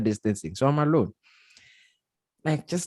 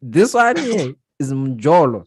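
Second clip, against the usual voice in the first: amused commentary; 4 semitones higher, 7 dB louder than usual. Retold or imitated. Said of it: "And I'm like".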